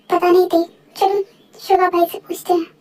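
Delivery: normal talking voice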